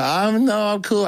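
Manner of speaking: high-pitched polite refusal, acted out; uneasy brush-off. Storyteller replaying how he dodged the preacher, high uneasy refusal. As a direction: wave him off in a high voice. Nervously declining the evangelist's approach.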